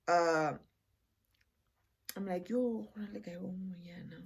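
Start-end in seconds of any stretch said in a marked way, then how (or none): 2.5-4.2 whisper. Retold or imitated. Confiding to aunt in whisper.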